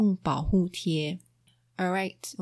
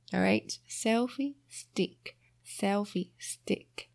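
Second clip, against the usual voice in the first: slow clear repetition; 3 dB quieter, slower than usual. Shown as a demonstration. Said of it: "slow soft repetition".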